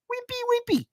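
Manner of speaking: wimpy, wimpy squeaked very high; squeaky wimpy voice. Pretend. Host mocking weak fans, squeaky and high. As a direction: squeak it like a tiny weakling.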